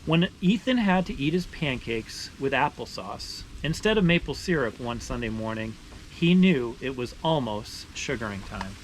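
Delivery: steady, expressive read-aloud voice; story narration. Acted. Reading a maple sugaring picture book aloud to viewers, storytelling voice. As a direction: tell it like a picture book for kids.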